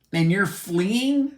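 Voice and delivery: high, slow, drawn-out incredulous question; scornful disbelief. Real, not acted. Mocking Russian men who flee, slow scornful question. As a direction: sneer the word fleeing in disbelief.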